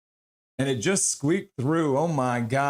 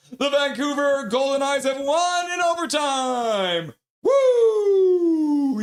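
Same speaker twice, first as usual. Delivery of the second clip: higher, shouted win announcement into a whoop; victory call; 9 semitones higher, 3 dB louder, slower than usual. Real, not acted.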